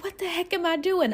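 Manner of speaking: high-pitched mock panic; retold panicked self-questioning. Newcomer recalling asking herself in alarm, retold panic. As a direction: pitch way up, questioning your own decision.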